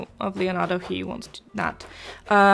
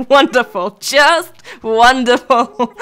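loud, drawn-out mock delight; sarcastic; 2 semitones higher, 8 dB louder, much slower than usual. Snowman reacting to its own lopsided body, sarcastic. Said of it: "That's some snowy sarcasm".

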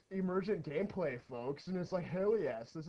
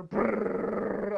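loud imitated brrr engine sound; brrr noise; 3 semitones higher, 9 dB louder than usual. Gamer voicing a unit with a brrr noise.

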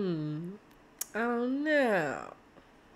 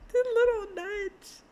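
high giddy squeal; 13 semitones higher than usual. Real, not acted. Gushing over a nudge.

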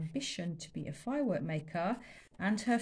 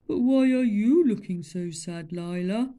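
loud booming concerned question; gentle elephant; 9 dB louder than usual. Acted. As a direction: ask kindly. Elephant asks Lila.

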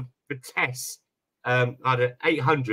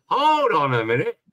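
raised, higher-pitched "hang on"; retold urgent interruption; 4 semitones higher, 4 dB louder than usual. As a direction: cut in urgently, voice jumping up. Retold sudden alarm on phone.

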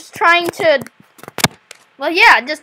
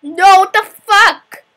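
frustrated; 4 semitones higher, 4 dB louder, slower than usual. Real, not acted. Code breaks unexpectedly.

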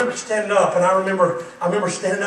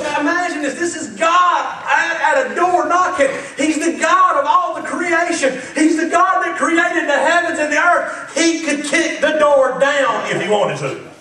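loud, high preacher build; preaching at full force; 9 semitones higher, 10 dB louder, slower than usual. Real, not acted. Preacher painting God at the door, loud. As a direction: thunder it to the church.

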